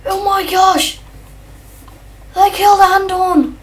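loud, high, upset outcry; shocked dismay. Real, not acted. Player finding his cat killed by the shark, shocked outcry. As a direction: cry out as the pet is lost.

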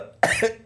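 sharp high cough from choking. Real, not acted. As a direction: cough hard, choking on a drink. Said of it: "je m'étouffe".

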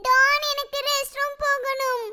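put-on high, slow little-girl voice; small child. Little girl tells Dan she needs the restroom, slow childlike plea. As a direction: whine it like a little kid.

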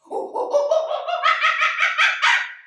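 high, loud monkey chatter and laughter; crazy monkey noises. Pretend. Teacher playing a crazy monkey for the kids, chattering. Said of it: "Can you be a crazy monkey".

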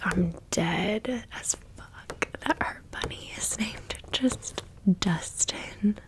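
usual speaking voice

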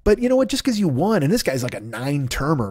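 usual speaking voice

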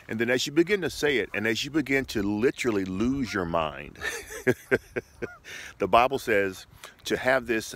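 ordinary talk

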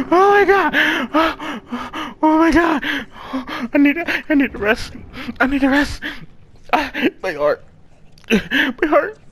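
high, gasping, overwhelmed whimper; near tears. Real, not acted. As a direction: catch your breath, almost crying after the scare. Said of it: "I'm crying".

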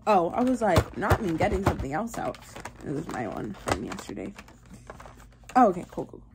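ordinary talk

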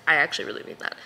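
normal talking voice

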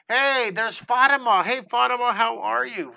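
high, loud happy hello; excited greeting. Real, not acted. Teacher greeting a longtime student on the stream, excited and loud.

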